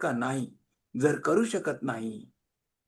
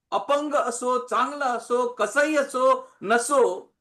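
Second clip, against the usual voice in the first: raised, sustained preacher's chant-like list; ringing sermon cadence; preaching proclamation; 6 semitones higher, 6 dB louder, slower than usual. Acted. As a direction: proclaim it high and loud, item after item. Proclaims scripture in a rolling list.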